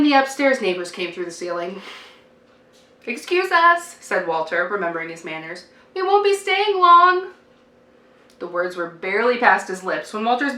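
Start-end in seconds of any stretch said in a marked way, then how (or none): 3.0-7.3 put-on high, loud polite boy; polite child voice. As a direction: chirp it like a well-mannered kid.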